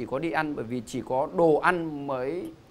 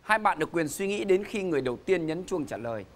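rally the players for round two; game show host; 4 semitones higher than usual. Acted.

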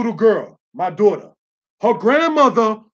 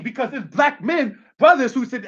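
ordinary delivery

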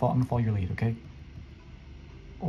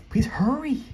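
high-pitched squealed plea, rising at the end; impatient high squeal; urgent plea; 10 semitones higher, 4 dB louder, slower than usual. Real, not acted. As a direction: plead louder and much higher, desperate for haste. Same plea repeated, now urgent.

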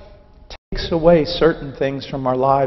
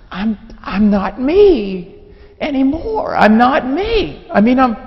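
high, slow, halting panic; retold fear; 9 semitones higher, 5 dB louder, slower than usual. New convert confessing to his friend, scared and high-pitched. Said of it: "I'm scared".

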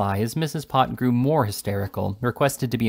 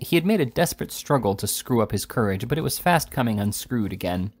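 plain reading voice

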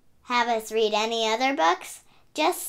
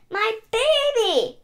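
high, louder, slow joyful cry; proud cry; 13 semitones higher, 6 dB louder than usual. Acted. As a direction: cry out with pride. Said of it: "cried Pig with pride".

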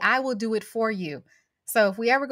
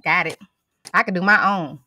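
usual speaking voice

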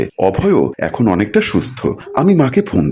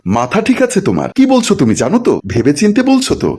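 high-pitched, incredulous questioning; shocked disbelief; 10 semitones higher than usual. Acted. Husband reacting to wife's divorce demand, shocked disbelief. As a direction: challenge her in stunned disbelief.

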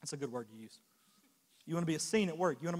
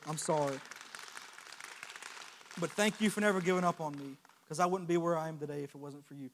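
ordinary talk, nothing marked

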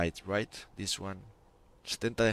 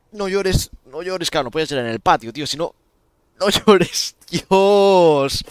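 mock pained plea; 5 semitones higher, 10 dB louder, slower than usual. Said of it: "y decías".

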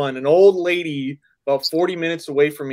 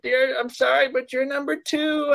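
put-on old lady voice; 10 semitones higher than usual. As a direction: play her as a sweet ancient lady. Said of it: "I'm just putting an old lady voice on".

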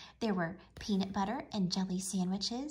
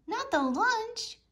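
much higher, slow dismayed wail; 13 semitones higher, 4 dB louder, slower than usual. A narrator's reading. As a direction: wail over the lost lunch. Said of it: "groaned everyone".

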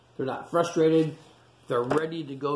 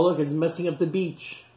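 usual speaking voice

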